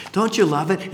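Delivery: normal talking voice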